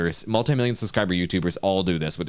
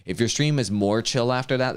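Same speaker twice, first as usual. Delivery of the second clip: ordinary delivery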